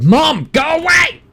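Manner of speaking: loud high yell, stretched out; shout. Real, not acted. Gamer yelling at his mom to go away.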